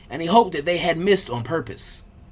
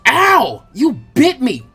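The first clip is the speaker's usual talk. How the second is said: sudden pained yell, higher and louder; shouted; 7 semitones higher, 8 dB louder, much slower than usual. Acted. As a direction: yelp it out after being bitten. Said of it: "the original Naruto shouted".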